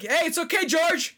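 high-pitched calling out; retold yelling. Canvasser calling after a student, high mock yell. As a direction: imitate a stranger hollering reassurance after someone. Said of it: "he kept yelling".

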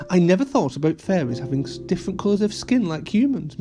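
plain reading voice

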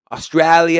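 put-on Australia with an Aussie "æ" vowel, light Australian accent; a quick Aussie take on the word Australia. Host trying an Australian accent for viewers, light Aussie vowel. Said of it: "that was a good accent".

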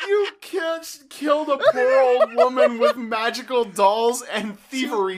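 laughing disbelief. Real, not acted. Laughing shock at the woman's death.

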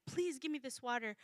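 hushed, humble request; humble plea. Acted. Woman at the well asking Jesus with humility, quiet. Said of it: "with humility".

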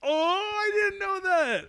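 high, slow amazed realization; surprised. Real, not acted. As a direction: gasp out the surprise. Learning Harvard is in Boston.

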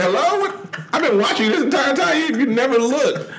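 high-pitched, exasperated retelling breaking into laughter; comic outrage at a partner who never looks; exasperated mock complaint. Retold exasperation at being ignored.

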